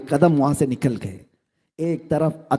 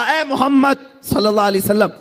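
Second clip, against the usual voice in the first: a mild called-out plea, pitched higher; calling out; 4 semitones higher than usual. Retold or imitated. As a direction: call after him from a distance, begging. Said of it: "پکار کر کے کہا".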